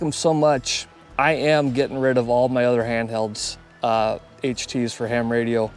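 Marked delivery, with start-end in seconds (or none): none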